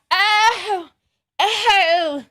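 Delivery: ordinary delivery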